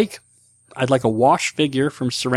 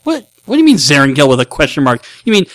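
rising to a high shout; indignant yell building up; mock outrage; 10 semitones higher, 10 dB louder than usual. Reading listener's outraged name correction.

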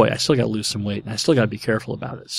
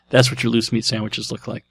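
ordinary delivery

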